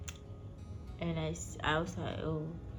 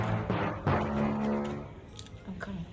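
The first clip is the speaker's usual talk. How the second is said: deep voice; 11 semitones lower, much slower than usual. Acted. Edited low voice insert.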